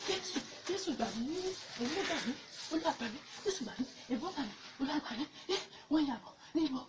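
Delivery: hushed, frantic pleas in short bursts; panicked prayer. Acted. Evangelist fleeing, begging Jesus to save, panicked prayer. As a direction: beg repeatedly for rescue under your voice.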